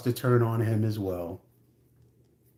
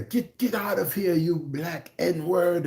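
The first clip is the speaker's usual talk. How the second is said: higher, slowed mock angry yell; mock furious wife; 7 semitones higher, slower than usual. Wife throwing him out in a mild mock rage. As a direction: act out the wife losing it. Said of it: "she loses her shit".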